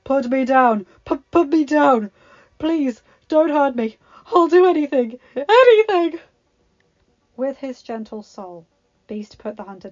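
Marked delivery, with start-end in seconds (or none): 0.0-0.9 loud frightened yell, hunter dangling; panicked shout. Acted. Hunter lifted into the air. 1.0-2.1 higher, louder stuttered plea; stammering panic. Acted. Hunter lifted into the air. 2.6-6.2 loud pleading, climbing much higher to a shriek; frantic begging. Acted. Hunter begs for his life.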